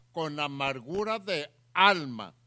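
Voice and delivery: "amargura de alma" loud, with "alma" set apart; pause, then hit "alma" hard; emphatic key phrase. Shown as a demonstration. Preacher asking listeners to value the phrase, emphatic on "alma". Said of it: "debemos valorar la palabra".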